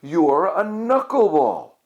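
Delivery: high, slow, drawn-out reveal; excited discovery. A narrator's reading. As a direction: announce it with delight. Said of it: "cried Connie".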